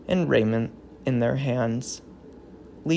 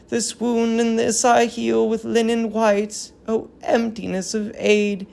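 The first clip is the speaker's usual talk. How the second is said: solemn tragic verse, lightly voiced; a hint of an old queen grieving; grieving queen's lament; 6 semitones higher than usual. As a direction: mourn the fallen city in measured verse. Hecuba binds the child's wounds.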